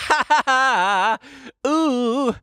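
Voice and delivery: high-pitched "ha, ha, ha" and a long "ooh"; gloating mock laugh. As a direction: crow with exaggerated, high fake laughter. Gloating after winning a jinx.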